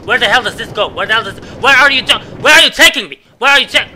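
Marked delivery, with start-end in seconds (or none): 1.6-3.9 high, loud startled yelling; panicking as the cart carries him off; alarmed shout. Real, not acted. Gamer yelling at the runaway minecart, startled.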